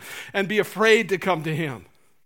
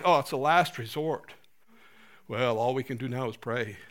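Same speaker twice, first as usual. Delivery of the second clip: resigned sigh; 7 semitones lower, 5 dB quieter than usual. Pretend. Resigned prayer as last resort.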